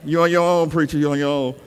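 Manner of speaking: low aside, lightly voiced brother; imitated congregant. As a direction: leave the preacher to it, in mock undertone. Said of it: "That brother back there talking about".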